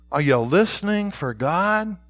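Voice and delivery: normal talking voice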